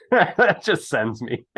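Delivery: giddy laughing rapture over a favourite composer; laughing delight. Real, not acted. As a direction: let the joy spill out in a laugh. Gushing over Vivaldi.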